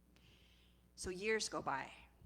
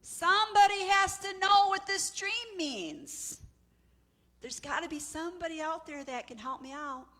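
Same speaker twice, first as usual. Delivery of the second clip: persistent demand; 10 semitones higher, 9 dB louder than usual. Acted. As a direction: cry out desperately, then back down in volume. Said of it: "Pharaoh gets more and more persistent".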